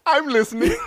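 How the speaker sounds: laughing through the words. Real, not acted. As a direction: laugh while trying to speak. Laughing at breast milk story.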